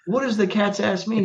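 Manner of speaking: high, laughing whoa to break it up; a laughing high-pitched whoa, then the question. Retold or imitated. Retold stopping a fight, laughing.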